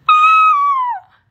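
sudden very high loud squeal; high shriek. Real, not acted. Owner squealing very high at the pet. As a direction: squeal in delight.

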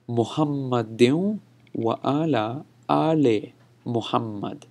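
slow, measured "Muhammadin wa ala ale Muhammad", phrase by phrase; recited verse demo. Shown as a demonstration. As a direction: model "ale" slowly within the verse. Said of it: "exactly how it is said in this verse".

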